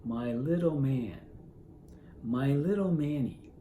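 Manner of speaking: slow, drawn-out crooning; mother cooing to baby. Retold or imitated. Wife walking the baby about, slow tender croon. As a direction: stretch the words out soothingly, as to a baby. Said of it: "picks up the baby and begins to walk about with it and say".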